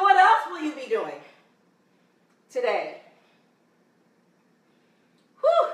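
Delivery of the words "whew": high, loud whew of exertion; breathless whew from a shaking plank; winded whew. Real, not acted. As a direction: blow out hard mid-plank. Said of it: "Kinda shaking already".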